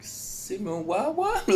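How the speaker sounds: high squeaky double take; can't believe what he's hearing; high cracked what-what; stunned disbelief. Retold or imitated. Hearing about the simulation.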